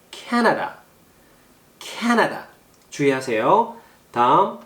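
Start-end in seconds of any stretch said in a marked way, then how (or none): 0.1-2.5 "캐나다" with a high, stressed first syllable. Shown as a demonstration. Teacher warns about accent in Canada, first-syllable stress. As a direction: hit 캐 high, let 나다 fall away. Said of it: "액센트가 이름절이에요".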